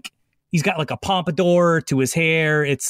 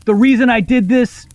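kenku mimicry; 4 semitones higher, 6 dB louder than usual. Kenku bird replaying the gnome's threat to the court, loud and high. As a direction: squawk it back like a recording of a shouting gnome. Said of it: "opens its mouth one more time to say".

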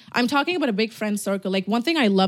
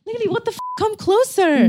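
mock-shocked squeal; 12 semitones higher, 4 dB louder than usual. Real, not acted. Teasing friend to come closer.

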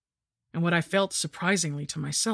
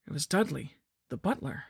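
usual speaking voice

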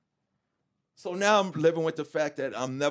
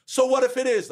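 higher, louder dismissive snap; a mother caught out, answering sharply; defiant mother; 11 semitones higher, 7 dB louder than usual. Retold or imitated. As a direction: throw it back at her, not caring.

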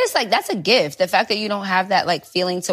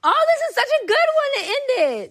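excited high. Real, not acted. Excited over card.

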